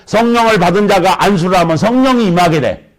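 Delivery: preacher thundering at the congregation; fiery preaching. Acted. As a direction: build the sermon to a fervent peak. Preaching climax, voice raised.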